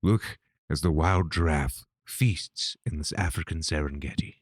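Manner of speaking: nature documentary narrator. Pretend.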